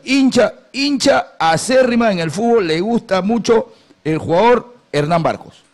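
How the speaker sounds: slow, drawn-out announcing; mock solemn. Host introduces the fan's favourite player, slow and drawn out. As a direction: stretch each word like a big reveal. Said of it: "¿por qué haces así?".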